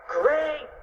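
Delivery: high-pitched cartoon yell; yelled approval. A narrator's reading. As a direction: call it out high, without full force. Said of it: "yelled Fluffy".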